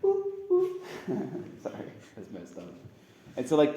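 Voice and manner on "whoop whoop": very high playful whoop whoop; mock whoop call. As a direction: call out like islanders luring a monkey. Islanders calling the monkey.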